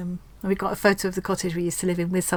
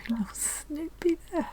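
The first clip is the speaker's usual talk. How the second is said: high, soft whispery squeak, moved; emotional; 7 semitones higher, 8 dB quieter than usual. Real, not acted. Crafter pointing out little Snoopy, tenderly emotional. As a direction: voice thinning to a tiny, touched whisper. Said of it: "I feel quite emotional".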